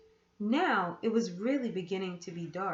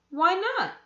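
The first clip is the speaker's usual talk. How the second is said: put-on curious boy; 9 semitones higher, 8 dB louder than usual. A narrator's reading. Boy asks aunt.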